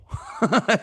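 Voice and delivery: a burst of high-pitched laughter. Real, not acted. Host laughing while retelling the rookie's jumper. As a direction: laugh out at the memory.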